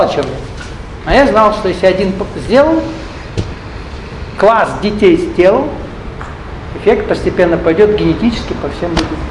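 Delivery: usual speaking voice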